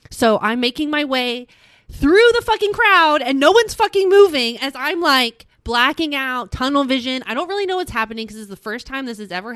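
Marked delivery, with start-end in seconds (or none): 1.9-7.2 higher, louder burst of exasperation; retold frustration. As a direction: relive pushing through a stuck crowd. Struggling out through packed crowd.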